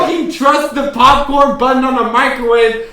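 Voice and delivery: loud, high-pitched rant; heated arguing. Real, not acted. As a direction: argue back at full volume. Heated argument over popcorn.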